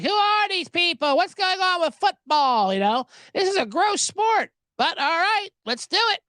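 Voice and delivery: high-pitched mock Swiftie voice; clueless fan imitation. Swiftie newcomers reacting to football, mocking high voice.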